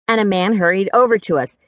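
plain reading voice

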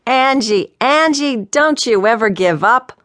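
tickled father, near laughing; amused, laughing. A narrator's reading. Father thinks his daughter set up a prank, amused. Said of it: "He was laughing now".

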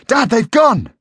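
high, loud call to a parent; shout. A narrator's reading. Boy telling his father of the empty tree, loud shout. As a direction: shout to get Dad's attention. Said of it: "he shouted".

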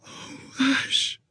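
soft breathy dying whisper. Acted. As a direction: breathe it out weakly. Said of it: "she whispered".

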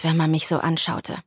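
plain reading voice

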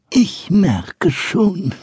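put-on high, very slow knowing old man; drawn out like a relieved old man; chuckling old carpenter. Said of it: "sagte er schließlich lachend".

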